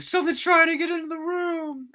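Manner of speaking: very high, loud re-enacted panic; panicked cry. Storyteller re-enacts waking the roommate in terror, panicked high cry.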